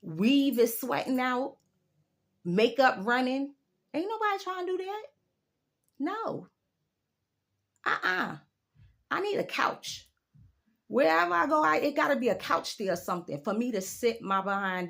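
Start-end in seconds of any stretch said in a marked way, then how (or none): 3.9-10.1 high, dismissive refusal. Real, not acted. Turning down the packed club, high and dismissive. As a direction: wave off the sweaty club scene. Refusing hot crowded clubs.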